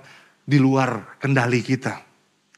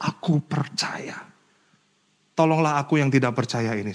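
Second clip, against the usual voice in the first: whispered plea; 5 dB quieter than usual. Retold or imitated. Father's plea, whispered not shouted.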